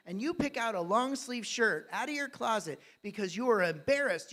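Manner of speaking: ordinary talk, nothing marked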